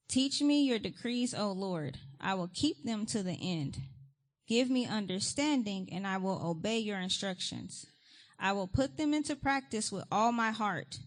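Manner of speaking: a preacher reading Psalm one nineteen to the church; fervent scripture reading. Acted. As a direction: declare each line of the psalm with force. Reads the psalm aloud.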